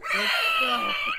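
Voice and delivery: extremely high-pitched shriek; hammed-up squeal of terror; mock scream. Player seeing a massive troll, mock shriek.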